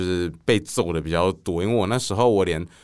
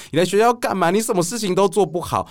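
scolding teacher; 10 semitones higher than usual. Retold or imitated. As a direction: tear into the pupil, voice climbing high. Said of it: "他就说".

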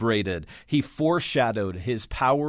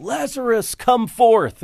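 booming call into the tomb, much higher and louder; yelled command; 12 semitones higher, 8 dB louder than usual. Retold or imitated. Pastor re-enacting Jesus at Lazarus' tomb, full booming shout. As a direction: shout it so it echoes off stone walls. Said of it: "And I yelled".

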